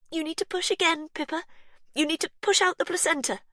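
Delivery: high, fast call; urgent urging. A narrator's reading. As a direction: press her on. Urging after birth.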